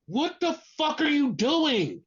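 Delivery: exasperated, drawn-out demand for an explanation. Acted. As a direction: stretch each word in disbelief. Exasperated reaction to wrestler's rant.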